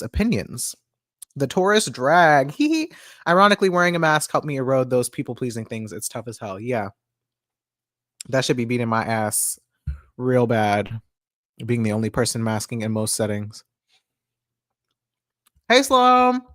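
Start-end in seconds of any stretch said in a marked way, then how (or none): 2.6-2.9 giggle. Real, not acted. Giggles after a remark. 15.7-16.4 calling out. Real, not acted. Calling out to someone.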